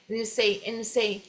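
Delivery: "And you say" begun on N, joined to "you"; clipped first vowel. Shown as a demonstration. Teacher repeats the cut form, clipped linking. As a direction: drop the A of "And" and link. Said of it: "corta até o A aqui".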